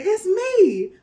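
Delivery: high, loud weary groan of a lion; beaten lion. A narrator's reading. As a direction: answer battered and sore. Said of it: "Lion said".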